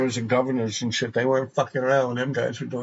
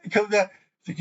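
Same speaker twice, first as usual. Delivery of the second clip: usual speaking voice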